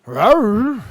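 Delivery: hammed-up lion roar. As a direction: roar it out to rile the dog. Bigger playful roar at puppies.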